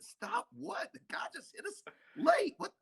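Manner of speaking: high-pitched indignant protest, retold; outraged protest. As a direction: squeak out the outrage at the referee. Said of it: "I said".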